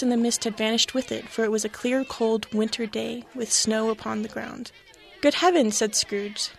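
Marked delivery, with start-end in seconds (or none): 5.2-5.7 higher, louder in wonder; astonished exclamation. A narrator's reading. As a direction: gasp it out in amazement. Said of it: "clasping his hands together".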